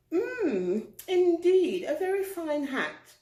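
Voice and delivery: high, lofty giraffe voice; haughty giraffe. Acted. Giraffe teasing the pig in a high, lofty voice. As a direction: look down your nose at the pig. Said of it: "she said".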